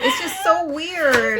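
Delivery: high, loud, slow laugh-through line. Real, not acted. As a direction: say it while cracking up. Laughing at the book.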